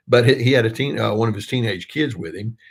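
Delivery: normal talking voice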